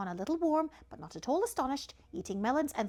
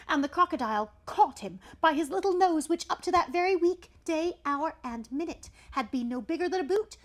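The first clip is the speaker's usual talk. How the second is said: loud, raised storytelling; sudden dramatic outburst; 6 semitones higher, 9 dB louder than usual. A narrator's reading. Storyteller as the crocodile catches the nose, loud and dramatic. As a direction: burst out loudly at the sudden attack. Narrating the sudden snap and grab.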